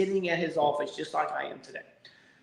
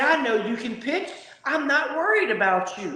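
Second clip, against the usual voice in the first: higher, lightly voiced coach reassuring; 10 semitones higher than usual. Skipper telling his young pitcher not to worry, lightly voiced higher. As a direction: play the skipper easing his pitcher's mind. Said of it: "He said".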